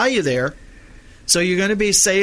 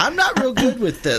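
higher, through laughter; laughing admission; 7 semitones higher than usual. Real, not acted. Host owning up to poor Dutch pronunciation, laughing. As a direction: laugh at your own clumsy attempt.

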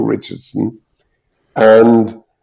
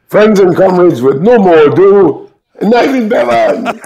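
chairman's announcement; 9 semitones higher, 3 dB louder than usual. Retold or imitated.

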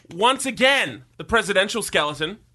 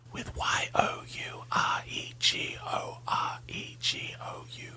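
unvoiced softer whisper; 10 dB quieter than usual. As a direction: whisper it close to the mic. Whispered song intro.

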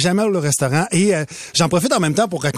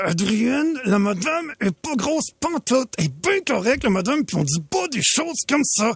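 softer, slow scolding through gritted teeth; angry dad through gritted teeth; 4 semitones higher, 4 dB quieter than usual. Retold or imitated. Dad scolds his daughter quietly through gritted teeth. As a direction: hush the kid sternly through gritted teeth. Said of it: "je parle avec les dents serrées".

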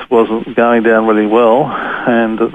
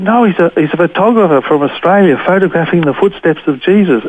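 higher-pitched voice of a guide vouching; mildly urgent protest; 6 semitones higher than usual. Retold or imitated. Teller voices his guide vouching for him to captors, protesting. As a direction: lightly imitate an earnest defender.

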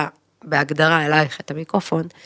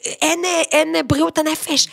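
fiery rant; 8 semitones higher than usual. Retold or imitated. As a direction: preach it like a firebrand speech. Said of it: "יוצאת בנאומים חוצבי להבות קיצוניים".

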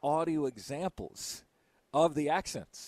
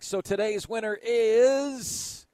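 drawn-out, higher winner announcement; suspenseful announcement; 5 semitones higher, 4 dB louder, slower than usual. Real, not acted. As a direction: stretch it out to build suspense.

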